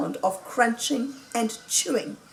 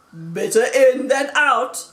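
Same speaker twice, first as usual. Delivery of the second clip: lightly voiced Badger, louder and higher; hearty Badger voice; 4 semitones higher, 6 dB louder than usual. A narrator's reading. Badger remarking on Mister Fox's belch, lightly voiced. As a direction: put on a touch of a character for the quip.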